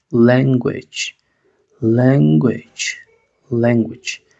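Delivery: "Language" with the a said as short e, lengwech. Shown as a demonstration. Teacher drilling the word, a as short e. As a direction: say "Language" as leng-gwech, repeated slowly. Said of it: "Esa A decimos E".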